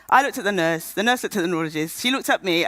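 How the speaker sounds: ordinary delivery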